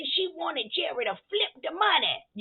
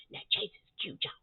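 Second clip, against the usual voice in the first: breathy confession of a crush; whispered aside about Chase; whispered gush; 12 dB quieter than usual. Real, not acted. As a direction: whisper it like a secret. Gushing about cute man.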